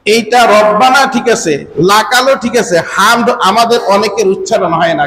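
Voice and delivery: ordinary talk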